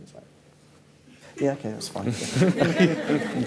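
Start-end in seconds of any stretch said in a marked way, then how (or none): 1.4-3.4 slow, amused, laughing yeah okay; easygoing reply. Retold or imitated. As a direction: shrug it off with a chuckle. His own easy reply retold.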